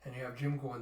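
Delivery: plain reading voice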